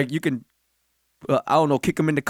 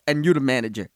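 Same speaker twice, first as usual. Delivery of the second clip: usual speaking voice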